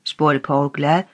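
plain reading voice

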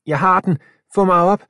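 high and loud, excited; urgent cry. A narrator's reading. Milo calling to Samir to pull him up, urgent. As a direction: yell up to be pulled. Caught phone, hanging over zombies.

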